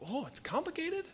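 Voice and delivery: echoing the trainer's words, high and incredulous; mock disbelief. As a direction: throw his word back in disbelief. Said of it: "I go".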